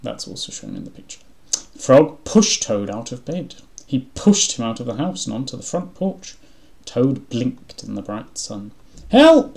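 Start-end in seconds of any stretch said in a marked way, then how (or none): 1.8-8.7 slow, emphatic read-aloud voice; story narration. A narrator's reading. Reading Frog and Toad aloud, emphatic narration. 9.1-9.6 very high, loud cry for help; alarmed shout. A narrator's reading. Toad pushed into bright sun, crying out.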